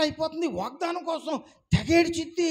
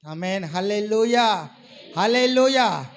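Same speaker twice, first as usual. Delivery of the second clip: worship praise; 3 semitones lower, slower than usual. Real, not acted. Worship exclamation.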